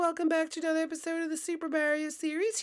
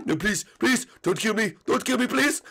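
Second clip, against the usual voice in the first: much lower put-on voice, begging; pleading fan; 10 semitones lower than usual. Fan begging Peach for mercy, frightened pleading. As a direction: beg for your life in a low voice. Fan begs for his life.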